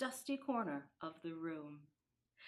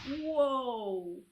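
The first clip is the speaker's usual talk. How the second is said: loud startled whoa; big surprised whoa from the sleigh; surprised cry; 3 semitones higher, 8 dB louder than usual. A narrator's reading. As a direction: Santa calling out in surprise. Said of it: "Santa cried out".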